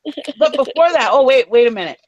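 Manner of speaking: ordinary delivery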